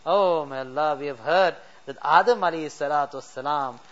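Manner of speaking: ordinary talk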